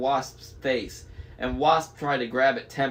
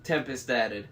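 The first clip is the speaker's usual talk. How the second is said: ordinary delivery, nothing marked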